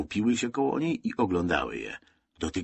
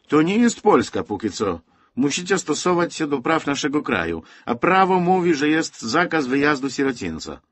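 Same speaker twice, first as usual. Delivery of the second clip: stern officer; 8 semitones higher, 6 dB louder than usual. Acted. NKWD officer lectures Zosia.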